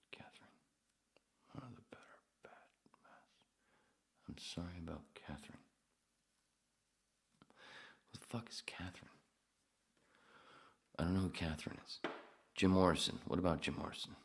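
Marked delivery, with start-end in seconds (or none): 0.1-9.1 hushed, soft whisper. Real, not acted. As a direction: whisper it to yourself. Whispering to himself.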